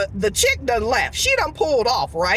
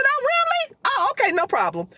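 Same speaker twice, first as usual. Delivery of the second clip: very high-pitched "oh, really", then falsely agreeable; mock surprise. To the manager throwing her out, sarcastically surprised. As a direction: feign surprise, then fake compliance. Retort to being thrown off property.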